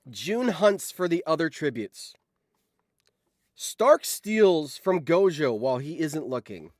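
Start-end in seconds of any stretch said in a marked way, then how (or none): none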